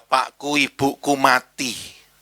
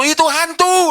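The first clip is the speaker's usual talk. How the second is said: high, louder cry of fright; frightened cry; 6 dB louder than usual. A narrator's reading. Disciples see Jesus on water, cry out in fear. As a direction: disciples shouting in fear at a ghost. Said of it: "mereka terkejut dan berseru".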